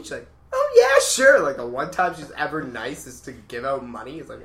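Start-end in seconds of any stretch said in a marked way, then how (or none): 0.5-1.4 high, loud gushing agreement; mock-agreeable teacher. Classmate retells teacher lending Chris a coin, mock-agreeable. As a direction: put on an over-eager yes, pitched way up.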